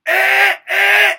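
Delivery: high, loud grunting eh; grunted scream. Shown as a demonstration. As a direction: grunt it right in front. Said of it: "he had a way of getting it".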